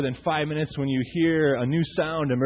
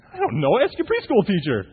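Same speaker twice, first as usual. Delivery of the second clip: mock-flustered parent with raised pitch; playfully defensive, a bit higher and louder; exasperated dad; 6 semitones higher, 4 dB louder than usual. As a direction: throw the question off onto someone else. Acts stumped dad answering child's question.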